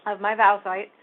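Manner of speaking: throat catching while speaking; choking. Real, not acted. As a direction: push words past a tickle in the throat. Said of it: "I'm choking".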